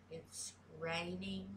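slow "raining" with a full -ing ending; sounded final G. Shown as a demonstration. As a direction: pronounce "raining" with the careful ng at the end. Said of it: "the G is always silent".

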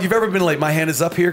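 normal talking voice

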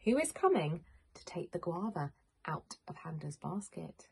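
ordinary delivery

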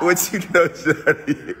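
laughing through a slow tease; slow teasing question through laughter. Real, not acted. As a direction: get the words out while cracking up. Teasing her on live.

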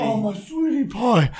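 put-on very high sugary baby talk, slowing down; fake sweet voice. Owner sweet-talking the dog in public, high sugary coo. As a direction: coo at the dog while seething underneath.

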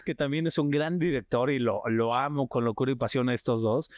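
ordinary talk

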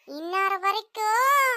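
ordinary talk

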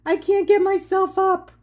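loud, high, helpless cry; stuck and laughing. Retold or imitated. Stuck on the zipline, calling loud and high to her friend. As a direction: plead that you can't get up. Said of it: "I said".

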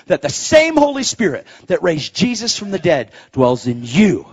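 impassioned preaching. Real, not acted. Preacher charges congregation with their mission, impassioned. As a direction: drive it home to the crowd with force.

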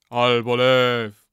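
slow, loud, booming echo; chorus response. A narrator's reading. Warriors echo the old man's curse, booming chant.

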